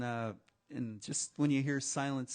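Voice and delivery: ordinary delivery, nothing marked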